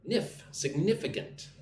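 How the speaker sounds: Nif then Significant with the nif stressed; teacher models Significant stressing nif. Shown as a demonstration. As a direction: land on nif inside Significant. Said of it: "Put the emphasis on the second syllable".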